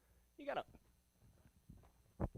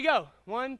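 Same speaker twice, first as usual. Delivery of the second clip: usual speaking voice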